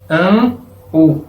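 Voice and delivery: nasal "an", a pause, then "u"; separated sounds. Shown as a demonstration. Teacher showing the two parts of the syllable, separately. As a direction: break "an, u" into two distinct sounds. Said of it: "pero no separadamente".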